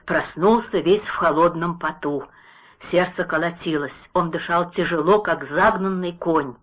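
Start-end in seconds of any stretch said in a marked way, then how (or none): none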